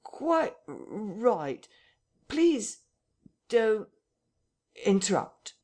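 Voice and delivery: high, halting delivery with "r-, right" stuttered; jerking intonation. A narrator's reading. The vicar answers through the door, "Quite r-, right" jerked out high. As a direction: jerk out "Please don't interrupt" word by word, with gaps. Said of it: "answered with a curious jerking intonation".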